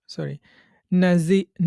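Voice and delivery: normal talking voice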